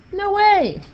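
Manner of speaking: high, loud, mock amazed; surprised. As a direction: gasp it like a big reveal. Mock surprise at cancelling terms.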